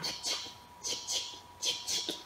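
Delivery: whispered tic tic like a camera shutter; camera click whisper. Shown as a demonstration. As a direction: click softly like taking a photo. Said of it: "Tu prends le mot en photo".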